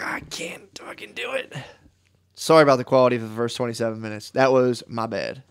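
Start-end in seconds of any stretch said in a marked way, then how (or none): 0.0-1.5 high, hushed squeal of giving up; mock whimper, squeezed high and very soft; strained whispered quote. Host retelling how he lost his nerve, strained high whisper.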